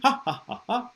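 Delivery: short, separate ha's bounced from the stomach; belly-bounced mock laugh; demonstrated laugh pops. Shown as a demonstration. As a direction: pop each ha like a staged laugh. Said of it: "pop, as if you're laughing".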